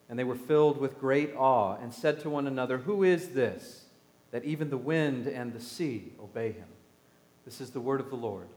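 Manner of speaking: calm, measured reading of the Gospel; scripture reading. Acted. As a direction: read it to the congregation, unhurried. Reads the Gospel aloud.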